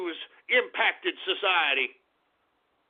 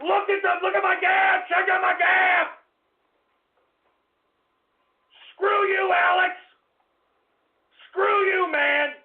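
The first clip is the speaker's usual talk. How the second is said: furious yelling; 8 semitones higher than usual. Acted. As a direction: yell it straight at Alex. Host enraged at Alex Jones.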